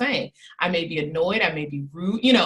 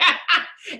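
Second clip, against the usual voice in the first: quick high laugh; amused giggle after a point; short giggle; light laugh; 8 semitones higher than usual. Real, not acted.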